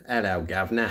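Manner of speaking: hammed-up Cockney-style British greeting; bad British accent. Shown as a demonstration. Streamer offering viewers bad ones, mock British accent. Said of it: "really want bad ones".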